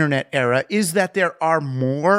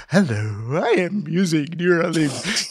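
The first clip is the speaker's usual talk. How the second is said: fake robotic pitch. Mocking how persuasion sounds fake, in a stiff canned voice. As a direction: sound like a stilted tech announcement. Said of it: "it sounds fake".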